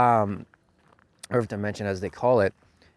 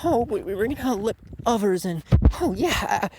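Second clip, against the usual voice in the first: high, sing-song parody of a pep talk; mock the cheesy encouragement, pitched way up; corny hero speech; 10 semitones higher than usual. Parodying the show's corny speech, high and mocking. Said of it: "the same corny story of".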